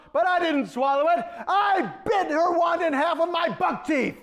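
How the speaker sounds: normal talking voice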